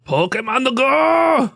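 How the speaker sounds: high, slow, drawn-out shout of "Pokemon the Go!"; goofy shout. Pretend. Cohost mangles the game's name, shouting slowly. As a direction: bellow it like a silly hype announcer. Jokey mangled game title.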